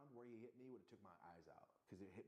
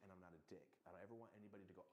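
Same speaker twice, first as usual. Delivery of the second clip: ordinary talk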